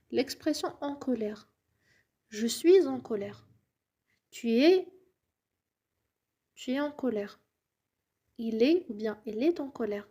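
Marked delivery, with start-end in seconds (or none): none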